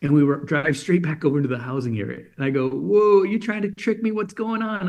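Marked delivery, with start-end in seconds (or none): 2.7-4.9 high-pitched imitated kid protest; mildly indignant surprise. Son suspects dad's trick in the car, mock-startled protest. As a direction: lightly act out a suspicious child, pitched up.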